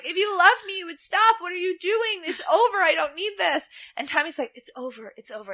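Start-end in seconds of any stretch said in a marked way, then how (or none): 0.0-3.7 put-on pleading girlfriend. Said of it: "And Alicia's like". 4.6-5.5 much softer, hushed "it's over"; calm him down quietly; really quiet. Retold or imitated. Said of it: "starts talking really quiet".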